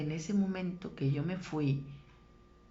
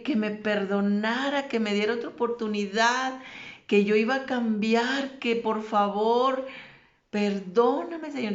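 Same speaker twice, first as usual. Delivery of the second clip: loud, raised, insistent begging; retold desperate shouting; 4 semitones higher, 7 dB louder than usual. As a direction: plead loudly, reliving his cries for forgiveness. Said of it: "y yo gritaba que". (ruego a gritos, desesperado)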